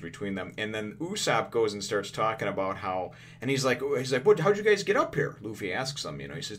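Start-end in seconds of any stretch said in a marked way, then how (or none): 4.3-5.4 high, louder disbelief; amazed question. Retold or imitated. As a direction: ask it wide-eyed. Usopp asks how they arrived.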